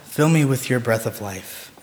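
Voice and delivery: soft, hushed opening prayer. Acted. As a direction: pray quietly to the Lord. Opening prayer before sermon.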